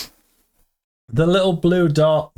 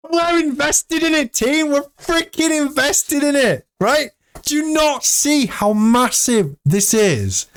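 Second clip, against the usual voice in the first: excited; 12 semitones higher than usual. Real, not acted. Rallying the team about holding.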